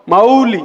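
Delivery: मौली with a long open औ; clear au in मौली. Shown as a demonstration. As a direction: stretch the औ of मौली. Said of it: "औ". (मौली में साफ़ औ)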